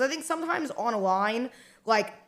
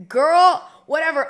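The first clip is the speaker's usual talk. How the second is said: put-on loud, high exasperated "girl"; 5 semitones higher, 9 dB louder, slower than usual. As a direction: fling out "girl" in disbelief. Exasperated retort in retold scene.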